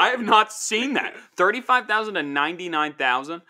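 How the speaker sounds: high, loud disbelief; shocked at the number; surprised. Real, not acted. Shocked at the salary range.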